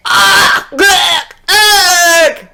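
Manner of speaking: high strangled ugh sounds; hammed-up gagging; choking groans. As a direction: gag as if overcome. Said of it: "She said".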